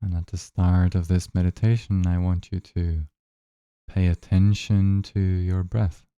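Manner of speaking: unhurried, even-toned guidance, leaving space between phrases; guided meditation. Acted. Guide asking listeners to notice their breath, calm guided meditation.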